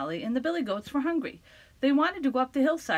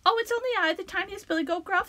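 high, tiny shy little goat; a timid, small voice; 9 semitones higher, faster than usual. Acted. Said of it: "in his very small voice".

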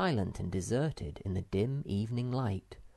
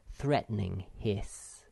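ordinary delivery

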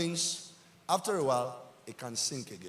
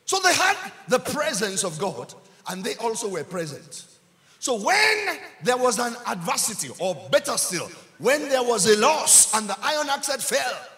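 loud, high-pitched sermon delivery; full-force preaching; 5 semitones higher, 9 dB louder than usual. Real, not acted. Preacher recounting the lost axe head, full-force preaching. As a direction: proclaim it loudly to the congregation.

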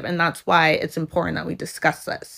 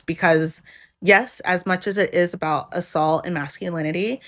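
usual speaking voice

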